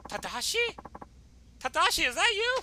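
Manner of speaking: put-on high, rising girlish voice; girl voice lifting in disbelief; astonished sister. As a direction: ask in high-pitched surprise. Sister recognises her lost brother.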